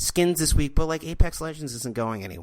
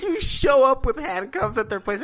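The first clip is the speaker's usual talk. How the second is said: higher, louder, giddy; excited shout; 10 semitones higher, 4 dB louder than usual. Real, not acted. Podcaster escalating the joke, giddy and loud. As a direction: shout it out through the giggles.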